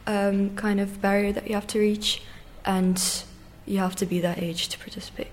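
ordinary delivery

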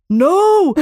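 high, loud desperate no; mock scream. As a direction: yell no as the line cuts. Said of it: "när jag skriker".

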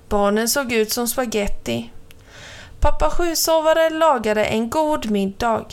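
usual speaking voice

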